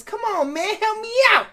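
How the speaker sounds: high-pitched mock pleading. Host acting the woman begging the tow driver, pleading. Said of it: "and she says".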